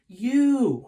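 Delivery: loud, high scream of the one word. Acted. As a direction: scream it in desperation. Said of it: "screamed Gray".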